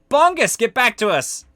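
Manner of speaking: high, loud summoning shout; calling out. Acted. Crew calling Bongus back to the ship, high shout. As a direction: yell for a straggler to come back. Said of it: "And then you hear a".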